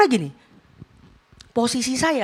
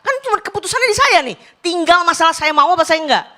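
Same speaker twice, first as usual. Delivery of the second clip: emphatic; 7 semitones higher, 7 dB louder, faster than usual. Real, not acted. Emphatic decision point.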